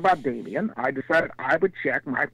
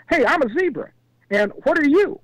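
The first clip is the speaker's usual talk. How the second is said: high zebra character introducing itself; eager zebra; 9 semitones higher, 3 dB louder than usual. Acted. Zebra greets a bull, high eager voice. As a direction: hail the stranger in a raised voice. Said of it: "trotted up to the animal and said".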